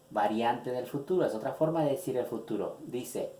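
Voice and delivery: normal talking voice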